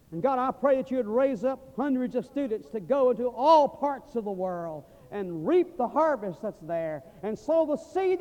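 closing prayer for the seminary students, rising to full force; fervent prayer. Real, not acted. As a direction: pray it out, building to a shout.

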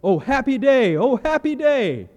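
loud and high, overjoyed, the second one drawn out slowly; joyful exclamation. Retold or imitated. Cambodian man greeting the preacher, a joyful loud shout. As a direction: shout it like a man bursting with joy. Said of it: "he goes".

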